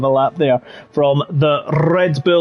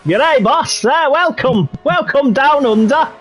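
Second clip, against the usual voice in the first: higher, playful mock Australian accent; a Yorkshireman doing a hammed-up Australian accent; 7 semitones higher than usual. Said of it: "I'm talking like to Yorkshiremen".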